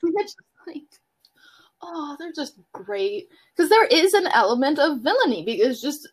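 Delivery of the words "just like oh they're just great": breathy hushed awe; whispered admiration. Retold or imitated.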